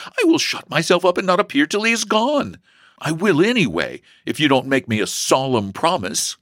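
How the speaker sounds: put-on high-pitched girlish insistence; almost desperate. A narrator's reading. Sister demands a promise from brother, desperate. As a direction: threaten him, pitched well up. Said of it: "said the almost desperate girl".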